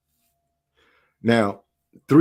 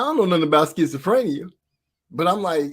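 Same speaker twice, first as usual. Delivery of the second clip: normal talking voice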